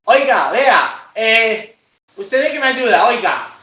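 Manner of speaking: loud, high, slow rough demand; rough caller. Shown as a demonstration. A caller demanding help in a rough tone. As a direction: bark it like a fed-up caller. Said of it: "Un ejemplo, la persona llama".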